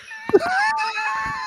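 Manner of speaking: high, loud shrieking laugh; helpless howling laugh; screaming laugh. Real, not acted. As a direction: cackle and shriek together. Laughing at perverse sight.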